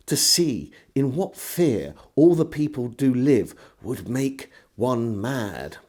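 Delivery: ordinary delivery, nothing marked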